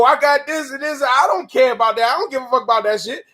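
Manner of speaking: ordinary talk, nothing marked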